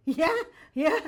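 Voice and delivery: high, over-eager mock yes; awkwardly trying to be funny. Nervous young actress answers Olivier, awkwardly jokey. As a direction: overdo the keenness for a laugh. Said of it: "trying to be funny".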